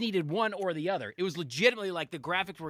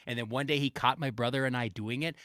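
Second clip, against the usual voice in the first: ordinary delivery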